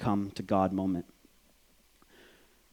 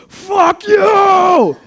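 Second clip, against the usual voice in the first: long, very high full-force scream; freaked-out scream; 14 dB louder than usual. Retold or imitated. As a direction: scream it out, drawn out and hysterical. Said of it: "Vietnamese guy goes".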